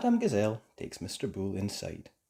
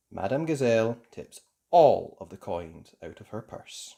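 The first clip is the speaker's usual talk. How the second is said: ordinary delivery, nothing marked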